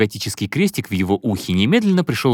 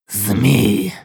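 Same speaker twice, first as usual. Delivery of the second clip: hissed with hatred; sinister hiss; 3 dB louder than usual. Acted. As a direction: spit the word with menace. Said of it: "зловеще прошипел Азраил". (зловещее шипение)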